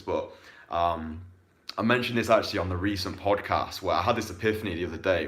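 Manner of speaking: ordinary delivery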